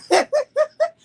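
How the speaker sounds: loud high burst of laughter. Real, not acted. Storyteller laughing after mentioning the stabbing. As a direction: let out a sudden high laugh. Laugh before serious point.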